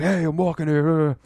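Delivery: high, slow New Yorker snap, imitating; hammed-up "I'm walking here" cabbie bark; New York street yell. Imitating a stormtrooper yelling at the car in a New York accent. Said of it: "they're like".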